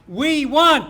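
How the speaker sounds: "We want" shouted loud, falling hard; call-and-response call. Real, not acted. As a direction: bark "We want" to cue the crowd. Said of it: "When I say we want you respond".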